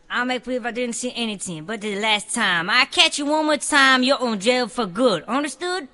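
gruff cop. Acted.

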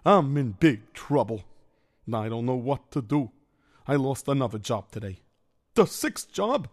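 put-on worried Yiddish-accented kvetching. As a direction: complain like a hapless schlemiel. Story figure complains.

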